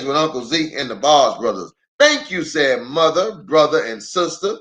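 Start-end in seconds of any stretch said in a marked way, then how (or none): 2.0-2.4 high sweet chorus thanks; family thanking together; children's thanks. Acted. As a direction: say it like the bear kids.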